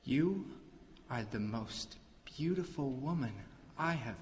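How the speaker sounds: soft, low, awestruck declaration; hushed adoration. Acted. God of the underworld to the maiden he holds, hushed adoration. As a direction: confess love softly, pausing between phrases.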